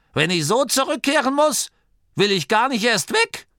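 high, insistent grumbling granny. A narrator's reading. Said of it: "grummelte Oma Morky". (nörgelnde, hohe Oma-Stimme)

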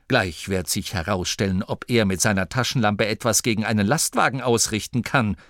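plain reading voice

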